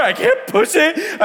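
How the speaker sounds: high, slow tearful wail on I can't push it; weeping. Retold or imitated. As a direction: drag it out sobbing, high. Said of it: "start weeping".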